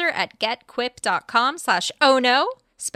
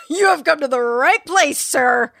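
salesman voice; 4 semitones higher, 7 dB louder than usual. Retold or imitated. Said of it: "And I said".

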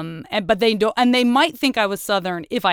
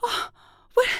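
high, delighted disbelief; amazed Parisian, lightly voiced and high; mock amazement; 13 semitones higher than usual. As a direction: gasp it like a charmed Parisian. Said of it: "they are like".

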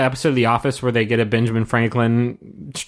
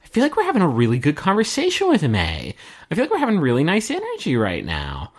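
exaggerated swooping sing-song; imitated earnest contestants; 5 semitones higher than usual. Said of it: "and they're like".

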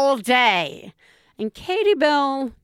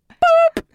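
very high, loud boop; high beep noise; 8 dB louder than usual. Pretend. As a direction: chirp it like a button press. Pushing a robot's buttons.

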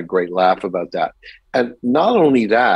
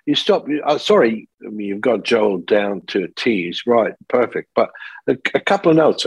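Tony Scott. Retold or imitated. British director giving notes mid-audition, British accent. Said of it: "it's a British accent".

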